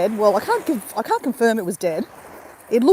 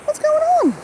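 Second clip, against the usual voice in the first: high squeal. Real, not acted. Startled by seagull noise.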